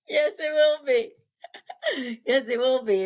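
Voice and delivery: laughing through the words; giggling while she answers. Real, not acted. Laughing at the book joke.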